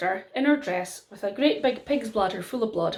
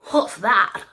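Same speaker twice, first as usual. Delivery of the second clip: king; 4 dB louder than usual. A narrator's reading. King asks about the stone.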